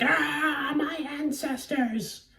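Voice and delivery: high-pitched, drawn-out outraged cry; mock Dracula yell. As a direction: imitate an offended vampire wailing high. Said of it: "like, yelling at you".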